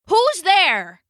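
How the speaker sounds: a loud, high demand at the door; the fly barks out, trying to sound big; loudest voice. Acted. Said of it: "he demanded in his loudest voice".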